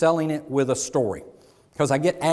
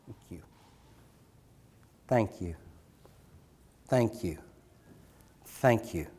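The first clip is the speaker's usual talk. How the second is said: soft thanks; 6 semitones lower, 7 dB quieter than usual. Shown as a demonstration. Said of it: "look at the customer in the eye and say".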